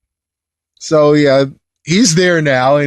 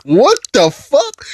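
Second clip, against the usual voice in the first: high, slow, stretched-out disbelief; incredulous reaction; 10 semitones higher, slower than usual. Real, not acted. Reviewer reacting to the weird lady's phone call, high baffled disbelief.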